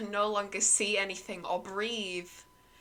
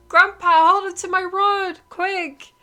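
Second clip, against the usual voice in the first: high, very loud panicked scream; boy screams at grandpa as boat breaks apart; 12 semitones higher, 11 dB louder than usual. A narrator's reading. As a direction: shriek it at grandpa. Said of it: "Terry screams".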